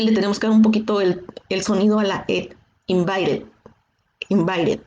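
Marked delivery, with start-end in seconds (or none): 2.9-4.8 "invited" with a flapped T and short ed; "invited" with tapped T. Shown as a demonstration. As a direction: say "invited" with the T flapped like a Spanish R and a light ed. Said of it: "La T pasa a sonar ahí como si fuera una R".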